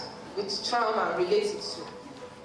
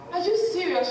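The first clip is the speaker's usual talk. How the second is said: higher-pitched retold disbelief; shocked disbelief; 7 semitones higher, 3 dB louder than usual. Reacting to her friend's revelation, shocked disbelief. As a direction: replay your own startled reaction. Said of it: "the same reaction came from me".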